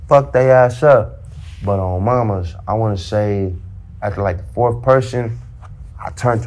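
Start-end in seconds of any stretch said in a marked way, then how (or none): 0.1-1.1 emphatic boast. Retold or imitated. Boasting about the fight.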